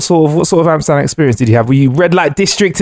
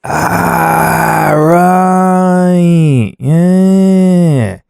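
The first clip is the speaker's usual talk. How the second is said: realization; much slower than usual. Real, not acted. Host realizing the munchies joke.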